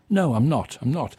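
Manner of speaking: ordinary talk, nothing marked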